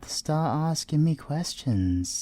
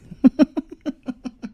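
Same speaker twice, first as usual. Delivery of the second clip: loud high laugh; loud teasing laugh; amused laugh; 13 semitones higher, 9 dB louder than usual. Acted. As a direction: laugh at the innuendo. Laughing at his own innuendo.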